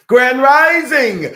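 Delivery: loud, high, drawn-out hail; big high-pitched show-opening call; exuberant greeting. Real, not acted. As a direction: belt out a big host's welcome, high and slow.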